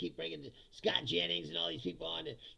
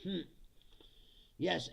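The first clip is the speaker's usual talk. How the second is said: normal talking voice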